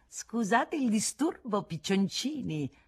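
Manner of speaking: slow, high, lilting mockery; teasing singsong. A narrator's reading. Hussonnet peeking in on the lovebirds, teasing singsong. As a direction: draw it out playfully to tease them. Teasing intruder interrupts the couple.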